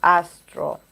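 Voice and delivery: normal talking voice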